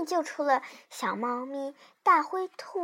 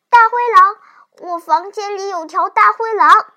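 loud, urgent cry for help; alarmed child; 4 semitones higher, 10 dB louder than usual. Acted. Child wakes mother about the wolf, loud and alarmed. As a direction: raise the alarm loudly.

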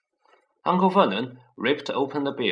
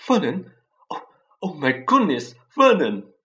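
louder, higher horrified call; shocked cry; 5 semitones higher, 8 dB louder than usual. Acted. Petunia cries out to her husband in horror.

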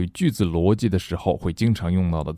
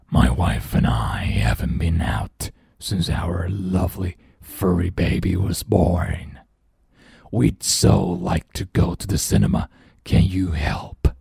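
deep scary monster voice. Acted. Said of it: "the deep, scary voice".

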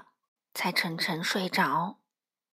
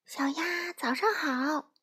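mild mock call, higher and drawn out; 6 semitones higher, slower than usual. A narrator's reading. Frog greets Duck across the river, mildly calling out. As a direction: call out long and high without real volume. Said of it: "弗洛格大声喊道".

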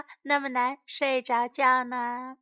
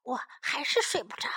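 ordinary delivery

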